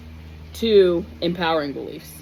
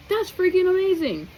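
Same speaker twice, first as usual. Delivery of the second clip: excited; 12 semitones higher than usual. Real, not acted. Cheering on the listener.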